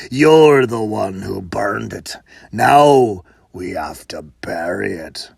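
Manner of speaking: a big brute grumbling at his partner; huge brute. Acted. Huge man blames, deep voice.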